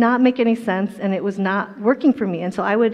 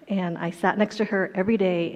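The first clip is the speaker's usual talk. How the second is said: ordinary delivery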